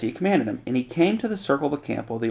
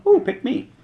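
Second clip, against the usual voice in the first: higher, eager mock plea; hammed-up eager volunteer; 12 semitones higher than usual. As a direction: play the keen kid begging to be picked. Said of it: "nobody's going".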